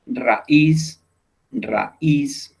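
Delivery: teacher sounding out "raíz" in two separate syllables; stress on last syllable. Shown as a demonstration. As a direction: punch the final "íz" of "raíz". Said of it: "el golpe lo estamos dando en la última sílaba".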